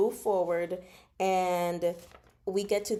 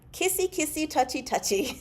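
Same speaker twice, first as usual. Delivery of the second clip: 1 teasing; 8 semitones higher, 3 dB louder than usual. Retold or imitated. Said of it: "My note says".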